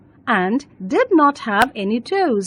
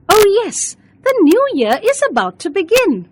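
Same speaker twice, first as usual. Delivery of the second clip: excited; 7 semitones higher, 4 dB louder than usual. Acted. Beena happily greets new year.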